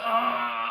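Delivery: long, high held "ah"; imitated defiant cry. Son looking at dad after the blow, mock wail. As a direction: hold a mock wail straight at him. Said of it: "I was like".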